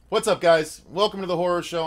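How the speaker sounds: ordinary talk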